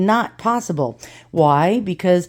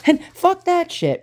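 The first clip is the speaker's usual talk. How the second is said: sharp indignant burst, higher pitch; indignant outburst; 10 semitones higher than usual. Real, not acted. Vlogger venting about the lazy mail subs, in an indignant outburst. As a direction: spit it out with outrage.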